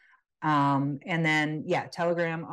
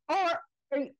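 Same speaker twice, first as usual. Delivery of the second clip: mock yell; 13 semitones higher, faster than usual. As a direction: pretend to call out a car window, pitched way up. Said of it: "and yell out".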